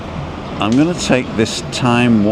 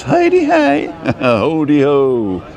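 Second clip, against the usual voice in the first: playful singsong; 5 semitones higher, slower than usual. Pretend.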